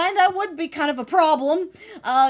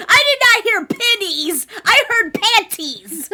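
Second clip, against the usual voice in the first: loud, high excited insistence; loud insisting; 6 semitones higher, 10 dB louder than usual. Real, not acted. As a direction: insist it loudly, laughing. Insisting she heard panties.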